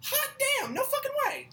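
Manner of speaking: very high, squeaky amazement; amazed disbelief. Real, not acted. Learning he played Nathan Drake, high-pitched amazement. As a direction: voice shoots right up in disbelief.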